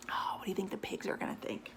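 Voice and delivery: fast conspiratorial whisper to the listener; whispered question. Real, not acted. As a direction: lean in and whisper the question quickly.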